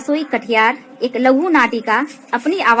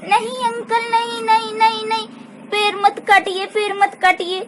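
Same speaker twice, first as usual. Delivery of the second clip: put-on child pleading for the tree; 9 semitones higher than usual. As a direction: beg the uncle not to cut the tree. Child begs uncle.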